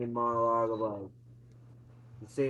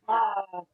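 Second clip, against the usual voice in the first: mock scream; 7 semitones higher, 5 dB louder than usual. Possessed mom screams from corner.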